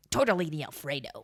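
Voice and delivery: low, lightly voiced actor impression; understated villainous restaurant order; Willem Dafoe. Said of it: "you're serving Willem Dafoe at an Italian restaurant".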